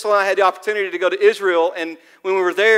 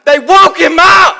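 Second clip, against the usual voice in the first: loud, much higher shout on woke; shouted wake-up; 8 semitones higher, 10 dB louder than usual. Shown as a demonstration. Preacher shouting how the disciples woke Jesus. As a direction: yell it like rousing a sleeper in a storm. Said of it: "I picture when the disciples woke him up".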